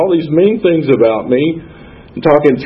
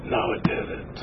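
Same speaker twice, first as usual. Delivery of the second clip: faint breathless whisper; whispered denial; 15 dB quieter than usual. Retold or imitated. Denying to the girl on the bus, whispered faintly. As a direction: barely whisper it, as if fading out.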